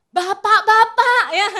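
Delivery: single out the fathers pointedly; emphatic call-out. Acted.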